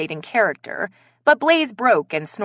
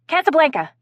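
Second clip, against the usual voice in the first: higher, louder excited cry; 10 semitones higher, 5 dB louder than usual. A narrator's reading. Ronnie names a favourite famous movie, excited. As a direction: blurt it out excitedly. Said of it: "Ronnie said excitedly".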